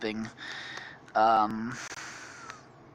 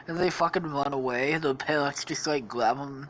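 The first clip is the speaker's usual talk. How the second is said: ordinary talk, nothing marked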